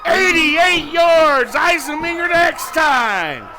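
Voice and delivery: high, loud play-by-play shout building to a roar; touchdown call. Real, not acted. Touchdown run called live.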